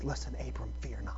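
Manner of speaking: soft, low hushed whisper; God quietly calming a frightened Abram; gentle God voice. Acted. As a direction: breathe the comfort into his ear. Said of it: "God comes to him and he says".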